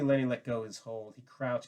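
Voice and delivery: usual speaking voice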